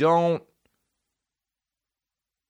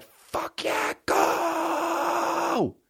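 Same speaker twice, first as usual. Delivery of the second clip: much higher, hammed-up cheering yell; mock fan scream; much slower than usual. A fan screaming for a player, in a hammed-up yell. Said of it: "scream for another guy".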